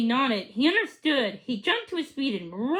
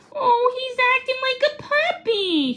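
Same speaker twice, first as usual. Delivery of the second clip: wondering; 12 semitones higher, 4 dB louder than usual. A narrator's reading. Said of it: "he said wonderingly".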